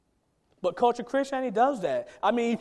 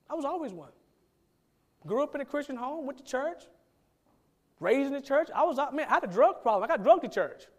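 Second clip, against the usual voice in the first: higher, eager voice of a churchgoer boasting his upbringing; higher-pitched caricature of a self-assured believer; 5 semitones higher than usual. Retold or imitated. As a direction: play a proud lifelong churchgoer, building then trailing off. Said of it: "His cultural Christianity would say".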